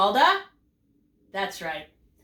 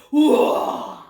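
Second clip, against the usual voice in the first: loud breathy whoa; loud, drawn-out whoosh of amazement; bigger and bolder; 5 semitones higher, 9 dB louder than usual. Shown as a demonstration. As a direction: burst out with a big exclamation. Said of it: "you want to say it".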